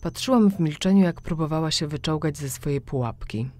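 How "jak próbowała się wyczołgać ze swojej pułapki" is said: usual speaking voice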